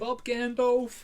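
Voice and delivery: drawn-out mock shout, high and loud; slow, high, loud comic yell; silly shout. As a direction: bellow it slowly like a daft joke. Mocking Band Aid mishearing.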